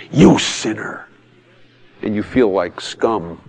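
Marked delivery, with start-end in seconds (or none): 0.1-1.1 drawn-out, mildly mock condemnation; lightly put-on accuser's voice; accusing preacher. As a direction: imitate someone pointing out a sinner. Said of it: "they're used to the guy going".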